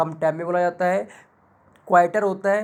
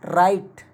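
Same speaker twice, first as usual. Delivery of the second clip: Right with रा stretched and a light इट; stress on रा; 4 dB louder, much slower than usual. Shown as a demonstration. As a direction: draw out the रा in right. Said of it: "थोड़ा सा जोर देके खींचा है".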